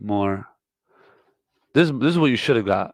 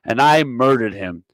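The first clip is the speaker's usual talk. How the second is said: ordinary talk, nothing marked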